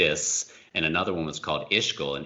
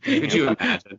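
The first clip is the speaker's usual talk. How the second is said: silly director voice; 8 dB louder than usual. Pretend. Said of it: "I would just talk in that voice".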